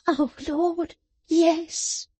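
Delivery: rock and moan in misery. Acted. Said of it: "moaned Fledgeby".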